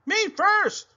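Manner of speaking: very high, loud shriek. Acted. Galloping pig shrieks very high and loud. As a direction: scream to be first. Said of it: "Pinkerton shrieked".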